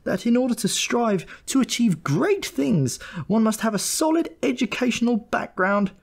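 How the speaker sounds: high, ranting mother's voice; shrill scolding mother; mother's tirade. A narrator's reading. Mother scolding her son over his misdeed, high and ranting. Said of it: "this sort of jabber".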